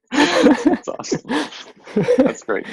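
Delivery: high and loud with delight. Real, not acted. Host delighted after the card trick, excited. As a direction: gush over the trick.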